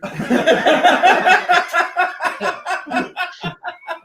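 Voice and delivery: high shrieking laugh; howling laugh. Real, not acted. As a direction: burst out laughing hard. Wild laugh at the scene.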